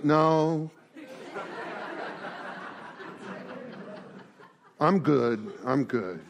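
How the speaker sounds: low, fading, with a little laugh; mopey refusal. Acted. Lonely man turns the friends away again, low and fading.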